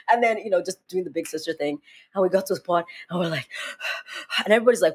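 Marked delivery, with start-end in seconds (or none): 3.5-4.4 breathy, voiceless gasps; imitated panting. Reaching a safe spot after fleeing, acted panting. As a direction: pant as if just done running.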